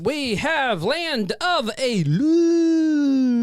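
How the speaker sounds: very slow, high drawn-out reveal; dramatic announcer. Acted. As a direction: stretch it like a movie trailer. Dramatic sponsor intro.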